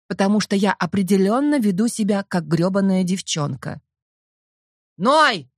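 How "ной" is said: barking. A narrator's reading. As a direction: bark the name. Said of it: "вновь рявкает папа".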